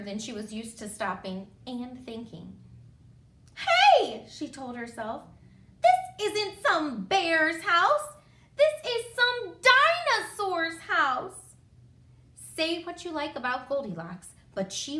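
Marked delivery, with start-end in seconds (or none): 3.5-4.3 very high, loud "hey"; startled little-girl exclamation; sudden realisation. A narrator's reading. 5.8-11.5 slow, loud, high outburst; hammed-up indignant little girl; dawning realisation. A narrator's reading. Goldilocks realises where she is.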